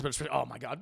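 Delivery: ordinary talk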